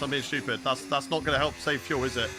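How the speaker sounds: ordinary talk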